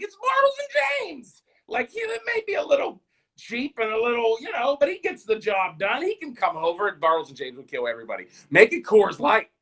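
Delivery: high shouting ad voice; cheesy pitchman. Retold or imitated. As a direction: sell it like a corny old commercial.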